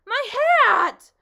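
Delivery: very loud, high indignant cry; outraged shout. Acted. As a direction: yell as the hat flies off. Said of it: "shouted the bug".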